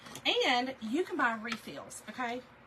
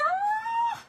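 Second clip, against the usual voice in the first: delighted squeal; 5 dB louder than usual. Real, not acted. Delighted reaction to Rita.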